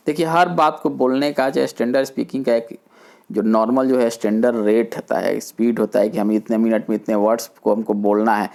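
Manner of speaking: normal talking voice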